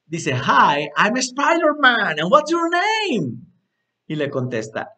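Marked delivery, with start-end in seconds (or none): 0.3-3.4 high, loud, drawn-out hero greeting; Spider-Man. Acted. Teacher acting Spider-Man greeting Batman, high hero voice. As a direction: call it out big and high, stretching the words.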